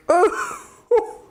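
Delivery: high, laughing squeal; playful high laughing gasp. Pretend.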